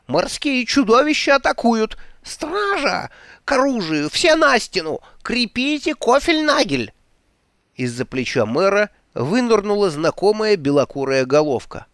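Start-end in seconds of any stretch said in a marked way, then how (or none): 0.1-6.9 panicked yelling. Acted. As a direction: raise the alarm in panic. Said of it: "завопил мэр".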